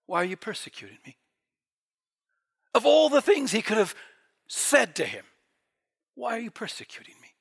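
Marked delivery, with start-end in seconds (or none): 0.1-1.2 soft, quick, mild reproach; gentle question. Acted. Jesus speaking to Saul, of all he could say, gently. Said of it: "isn't this the most gentle". 6.1-7.3 very soft, quickly spoken; gentle question. Acted. Jesus to Saul, no heavenly tyrant, gently. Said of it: "isn't this the most gentle".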